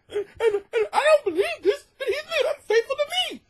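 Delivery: very high, fast, fake-sobbing whine; mock tearful writer. Imitating the letter writer, high mock sobbing. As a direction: parody her crying confession.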